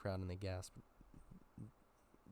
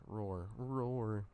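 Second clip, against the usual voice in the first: loud mock monster roar through a megaphone; growling roar; 5 dB louder than usual. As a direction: roar it out like a stage beast. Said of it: "Mabel's growling through a megaphone".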